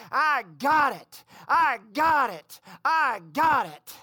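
loud, drawn-out triumphant cry; jubilant shout. Retold or imitated. Old woman on the tile floor shouting to heaven, jubilant shout. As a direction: shout it slowly, hammering each repeat. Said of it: "she shouted".

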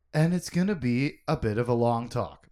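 usual speaking voice

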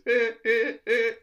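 put-on high, loud little-boy noises. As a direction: squeal it like an excited little boy. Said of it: "Lenny, like a little boy".

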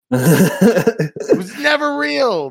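high, loud, long-drawn wail; mock-outraged shout. Insisting to co-host it never existed, in a mock-outraged shout. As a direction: stretch it slowly into a hammed-up yell.

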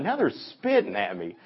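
high, laughing disbelief; half laughing, can't believe it; bewildered. Retold or imitated. Blind man, bewildered, voiced in retelling.

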